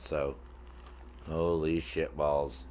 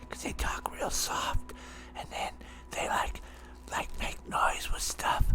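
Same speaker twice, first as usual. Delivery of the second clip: mock ASMR whisper, breathy and hushed; 9 dB quieter than usual. Shown as a demonstration. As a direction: whisper it like a paid ASMR streamer. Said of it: "I'm trying to be one of those whisper people".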